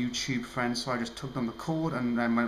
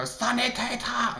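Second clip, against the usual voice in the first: "Sanitäter!" stretched out slow and higher in a hammed-up German accent; mock German cry; 10 semitones higher, much slower than usual. Shown as a demonstration. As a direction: let rip a drawn-out German "Sanitäter!" to blow off stress. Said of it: "run off somewhere and just go".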